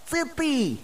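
high, thin retort from afar; shouting back. A narrator's reading. Friend answers the accusing boy, shouting back. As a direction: throw the blame back across a distance. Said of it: "кричить він".

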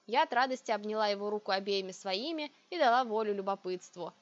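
plain reading voice

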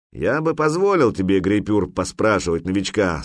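ordinary delivery

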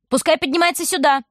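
high call from upstairs; wife shouts down. A narrator's reading. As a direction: insist she come up. Wife calls down.

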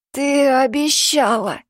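hoarse. Acted. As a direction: rasp it out, fading. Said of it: "Его голос стал хриплым". (хрипло, умоляюще)

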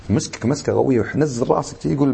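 normal talking voice

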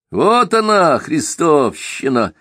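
high sharp then slow, gloating; gloating sneer. Acted. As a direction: sneer it out with malicious glee. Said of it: "злорадно засмеялся Симагин".

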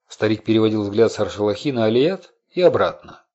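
usual speaking voice

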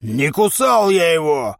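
gruff drawn-out denial; grumbling trainer. A narrator's reading. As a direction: deny it grumpily. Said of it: "буркнул тренер".

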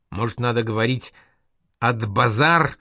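ordinary delivery, nothing marked

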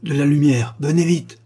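urgent, high-pitched command; urgent call. A narrator's reading. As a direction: summon help at once. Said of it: "Soudain, il appela".

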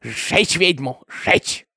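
very slow, hoarse-whispered venom; vicious mob cry. A narrator's reading. Mob calls to burn the witch, slow harsh hiss. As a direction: spit it out slowly, mostly breath.

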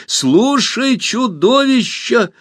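high, loud call; exclamation. A narrator's reading. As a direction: grab its attention. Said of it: "воскликнула Алиса".

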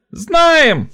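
high piercing shout from the crowd; piercing cry. A narrator's reading. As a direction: shriek it out. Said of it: "отозвалась пронзительно". (пронзительный выкрик)

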